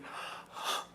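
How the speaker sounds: soft breathy gasp of delight; a quick swooning intake of breath; girl swooning at a compliment. Retold or imitated.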